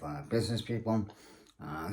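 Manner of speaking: usual speaking voice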